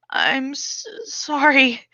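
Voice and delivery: shaken apology. Acted. Realizes she hurt brother.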